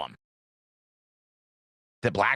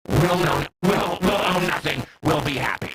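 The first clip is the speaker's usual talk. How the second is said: mocking slogan; 3 semitones higher, 3 dB louder than usual.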